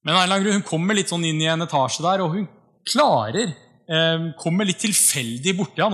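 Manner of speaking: ordinary talk, nothing marked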